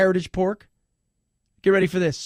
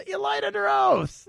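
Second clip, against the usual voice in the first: mock accusation; 13 semitones higher than usual. Host voicing a petty charge, mock accusation. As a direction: stretch it out in a squeaky mock scolding.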